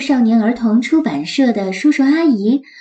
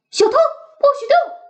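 much higher puppy shout; 大喝一声; 13 semitones higher than usual. Acted. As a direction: bark the command like a small dog. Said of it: "Max冲小虫大喝一声". (高声大喝)

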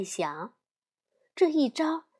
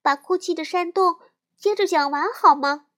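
high, pleading little sister; 6 semitones higher, 6 dB louder than usual. Acted. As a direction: beg sweetly like a small child. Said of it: "小熊妹妹央求小熊哥哥".

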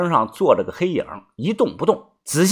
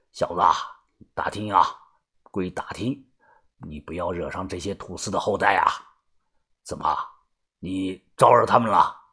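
low, soft elder's voice; grave old man; 8 semitones lower, 10 dB quieter than usual. Acted. Grandfather explaining a clan and warning the young man, grave. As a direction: warn quietly and gravely in a deep old voice.

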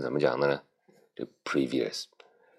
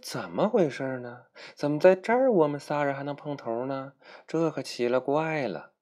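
character voice; 10 semitones higher than usual. Acted.

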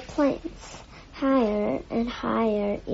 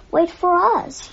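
louder, high-pitched shout; shouting after someone; 7 semitones higher, 7 dB louder than usual. A narrator's reading. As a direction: shout to a friend running off. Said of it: "shouted Jack".

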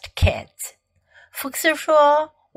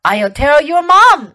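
loud, rising warning; tough threat; 6 dB louder than usual. A narrator's reading. Fox threatens unruly Ling kids, tough warning. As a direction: warn them firmly, with weight. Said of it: "Fox had to get tough".

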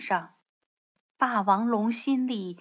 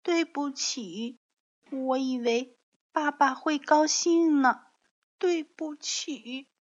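tearful apology; 5 semitones higher than usual. Acted. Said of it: "不要哭了".

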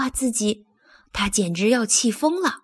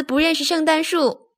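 mild yell, pitched higher; calling out; 6 semitones higher than usual. A narrator's reading. Duncan tells everyone about Thomas, calling out. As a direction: spread it around in a raised voice. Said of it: "邓肯又到处喊".

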